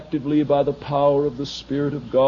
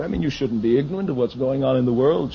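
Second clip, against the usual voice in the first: ordinary talk, nothing marked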